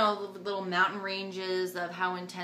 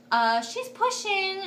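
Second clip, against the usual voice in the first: high, drawn-out mock alarm; retold urgent call; 11 semitones higher, 6 dB louder, slower than usual. Mom calling nurses about pushing, mock urgent call. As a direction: sing out the news high and loud.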